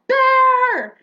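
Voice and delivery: panicked shriek of alarm, very high and loud; yelling bear. Retold or imitated. Kid shrieking a warning to friends, panicked. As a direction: shout the warning in terror. Kid yells at sound in woods.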